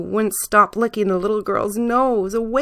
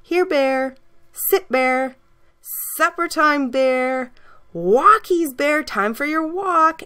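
put-on girl calling her dog; 8 semitones higher, slower than usual. A narrator's reading.

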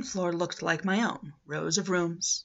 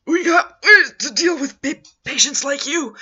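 higher, louder gasping threat, out of breath from the blow; 7 semitones higher, 10 dB louder than usual. Acted. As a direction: wheeze the words out in pain. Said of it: "he gasped".

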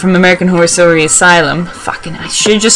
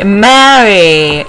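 usual speaking voice

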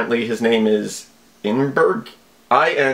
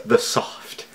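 breathy aside; 3 semitones lower, 5 dB quieter than usual. Shown as a demonstration. Mocking the odd phrase.

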